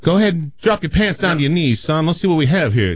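a breezy country doctor giving orders; folksy Southern doctor. Acted. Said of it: "He goes".

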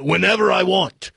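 put-on all-over-the-place Russian joke accent; mixed-up Russian accent. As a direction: do a comic bad Russian accent. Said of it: "this Russian accent's gonna sound like".

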